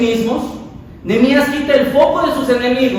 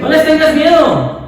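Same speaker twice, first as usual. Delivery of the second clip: said louder, as an exclamation; 6 semitones higher, 4 dB louder than usual. Shown as a demonstration. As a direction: proclaim it louder, with conviction. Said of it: "decirlo más fuerte". (más fuerte, con convicción)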